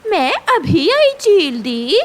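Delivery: high, far-off voiced hungry hawk; hawk character. Hawk tells the captured sparrow she wants meat, high creature voice.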